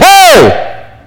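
high, drawn-out hail; play-acted holler from above; acted calling down. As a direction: call down into a hole, puzzled question. Passer-by calls down into hole.

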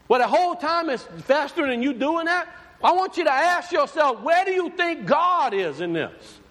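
high, impassioned preaching climb; fervent pulpit build; preaching at full force. Real, not acted. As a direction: raise it up and press the congregation. Preacher building, pitch raised.

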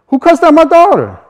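high, loud furious demand; angry father yelling. Retold or imitated. Angry father confronting the garbage crew, yelling. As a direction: bark it out like an enraged dad.

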